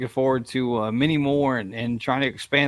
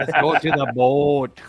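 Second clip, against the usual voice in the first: mock Minnesota accent; 4 dB louder, slower than usual. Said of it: "Sounds like boat".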